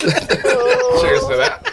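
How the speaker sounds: squealed high cheers through laughter; laughing toast. Real, not acted. Friend laughing as he toasts the Seinfeld mixup. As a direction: crack up while raising a toast.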